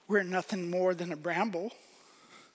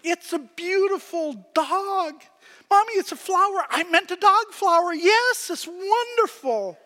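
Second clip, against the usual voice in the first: much higher and louder, overdone praise; hammed-up delight over the drawing; gushing mom; 11 semitones higher, 8 dB louder than usual. Mother gushing over child's drawing.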